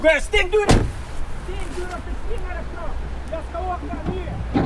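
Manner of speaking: frantic gunman. Acted. Fake hijacker frantically chasing the passenger off and hunting for keys. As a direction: sound like a crazed robber rushing to flee.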